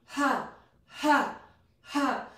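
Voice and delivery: quick punchy ha breaths; sharp panting exhales. Shown as a demonstration. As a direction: push each ha from the belly. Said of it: "breath comes out your mouth".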